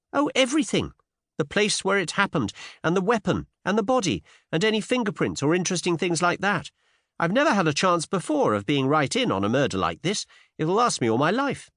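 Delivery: put-on high, eager young woman; thrilled Cinderella. A narrator's reading. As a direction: gush with high-pitched excitement. Cinderella, ghoulishly excited.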